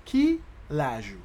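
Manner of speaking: "Quel âge" pulled apart into two beats; separated, stretched syllables; slow demonstration. Shown as a demonstration. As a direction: say it slowly for a learner. Teacher slows Creole phrase.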